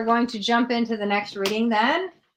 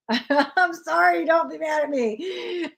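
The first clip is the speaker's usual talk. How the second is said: giggling through a sorry, a bit higher and slow; laughing apology; 5 semitones higher, slower than usual. Real, not acted. Reader to Hemant, apologizing for blunt news, laughing. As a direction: laugh it off as you apologize, slowly.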